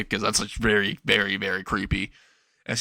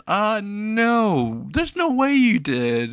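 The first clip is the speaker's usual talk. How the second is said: high-pitched, stretched-out denial; hammed-up refusal to believe, voice way up; acted disbelief; 10 semitones higher, much slower than usual. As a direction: draw it out slowly in nervous disbelief. Student reacts to cannibalism claim.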